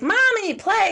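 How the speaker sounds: put-on high, loud kid calling for mom; put-on high-pitched kid's plea; pleading child. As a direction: imitate a child demanding playtime. Voices a child begging to play.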